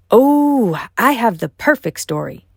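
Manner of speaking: bursting with eager excitement; high, loud burst of glee; an eager dragon who can't wait to tell it; excited. Acted. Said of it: "Mushu said excitedly".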